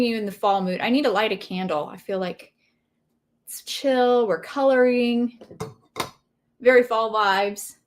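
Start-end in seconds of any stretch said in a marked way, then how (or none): none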